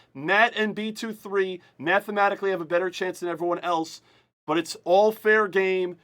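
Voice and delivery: slow, projected rules announcement; formal announcer. Real, not acted. Host reading out each team's entries, projected announcer voice.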